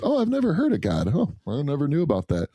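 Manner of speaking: a sing-song put-on of someone who knows nothing; mock naive voice. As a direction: play dumb, as if you had never heard of God. Mocking a claim of ignorance.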